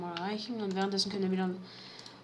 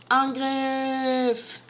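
battle cry; 4 semitones higher, 5 dB louder than usual. Real, not acted.

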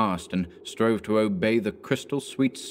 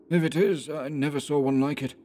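lightly voiced Anderson, higher and tense; Anderson, hushed; 7 semitones higher than usual. A narrator's reading. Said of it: "he answered in the same tone".

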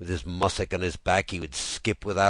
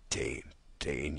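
quiet, lower, slowed repeat of the name; soft echo; 4 semitones lower, 9 dB quieter than usual. Acted. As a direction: let the name fade like a memory. Soft echo ending the poem.

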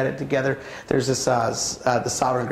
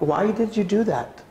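a hint of a gentle parent; 7 semitones higher, slower than usual. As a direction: ask patiently, wanting to understand. Gentle parent asking child.

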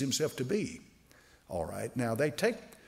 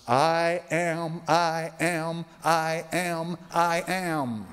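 I AM drawn out four times, each held on a raised, level tone; emphatic declaration; 3 semitones higher, much slower than usual. Acted. Preacher quoting Jesus before Abraham was, emphatic declaration. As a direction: proclaim each "I am" firmly and separately, higher than usual talk. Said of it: "an emphatic way of saying".

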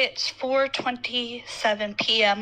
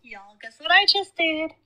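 excited; 6 semitones higher than usual. Real, not acted. Excited to show off new bathroom.

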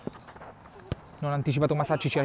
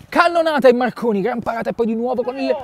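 excited save call; 6 semitones higher than usual. Real, not acted. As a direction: react to a thunderous strike. Big save, commentator erupts. (esclamazione eccitata)

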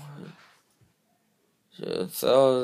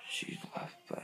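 whisper; 14 dB quieter than usual. Real, not acted. Hiding that she still records.

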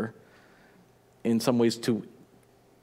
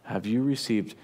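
softer, intimate preaching; earnest sermon close; 3 semitones lower, 4 dB quieter than usual. Acted. Preacher closing his sermon to the congregation, softer and earnest. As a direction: bring it home gently to the congregation.

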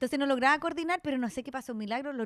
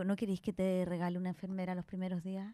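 lower, softer imitation of her mother; quoting mom; 7 semitones lower, 8 dB quieter than usual. Mother offering a nurse to her daughter, lower and softer.

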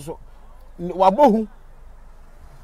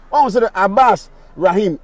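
ordinary talk

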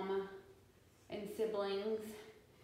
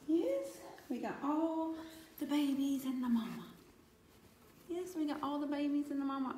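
higher, cheerful cooing to the cats; 6 semitones higher than usual. Real, not acted. Owner cooing to the reunited cats, delighted. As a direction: coo happily over the whole cat family.

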